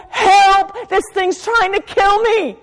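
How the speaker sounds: high, loud, crying out for help; preacher acting out a loud, high, desperate prayer. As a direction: cry out as if the thought is strangling you. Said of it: "and go".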